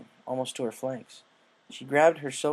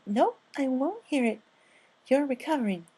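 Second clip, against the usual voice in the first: Fluttershy voice; 13 semitones higher than usual. Acted. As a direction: speak like a shy gentle mare. Fluttershy insists.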